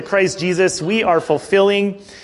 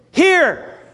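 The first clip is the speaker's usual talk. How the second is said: put-on much higher, sweet little-girl "Here"; 11 semitones higher than usual. Father imitating daughter Reagan offering herself, high girl voice. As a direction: squeak it up like a proud toddler.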